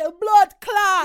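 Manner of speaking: very loud, high, drawn-out Jamaican outburst; Jamaican exclamation. Shown as a demonstration. Showing how a Jamaican might say it, loud Jamaican accent. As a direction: belt it out like a real Jamaican. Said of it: "But a Jamaican might say".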